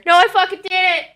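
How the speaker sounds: very high mimicked cousin voice; mock protesting cry. Cousin butts in from the other corner, mock high protest. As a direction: imitate a high-pitched shout from across the room.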